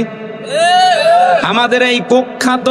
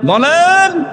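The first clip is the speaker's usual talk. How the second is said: higher, ringing call to the crowd; booming male voice; 8 semitones higher, much slower than usual. Shown as a demonstration. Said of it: "আরো জোরে বলেন".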